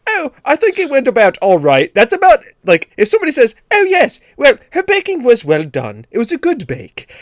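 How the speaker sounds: high, airy, prim British understatement; polite English judge. Retold or imitated. Mocking passive aggressive British bakers, high prim English voice. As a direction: sound gently disappointed, very British.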